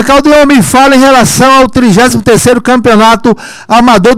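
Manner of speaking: loud phone interview. Real, not acted. Host talks loudly to phone guest.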